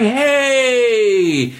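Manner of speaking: high, slow, sing-song buddy greeting; mock schmoozy coworker. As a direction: ham up a fake-friendly colleague. Coworkers schmoozing the client, mimicked.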